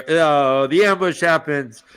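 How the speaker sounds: ordinary delivery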